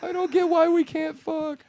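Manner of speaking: put-on high, slow girlish whine; whiny girl voice. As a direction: play the confused girl.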